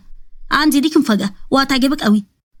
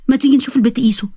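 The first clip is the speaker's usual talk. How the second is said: plain reading voice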